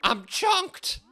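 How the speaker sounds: character voice. Acted. Game character voiced while playing.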